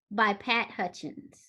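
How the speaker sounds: ordinary talk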